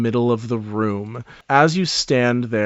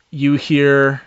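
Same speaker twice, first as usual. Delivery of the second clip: ordinary talk, nothing marked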